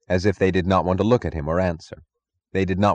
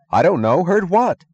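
high, loud alarmed questions; 11 semitones higher, 6 dB louder than usual. Acted. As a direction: demand it in alarm. Shocked at the news.

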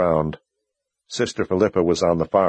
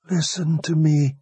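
a wounded man using his last strength to speak; dying young wizard; 9 semitones higher than usual. A narrator's reading. Dying Warren voiced.

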